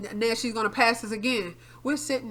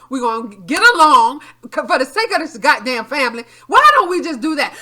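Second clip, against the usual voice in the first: retold firm telling-off; 3 semitones higher, 10 dB louder than usual. Arguing with mother in car.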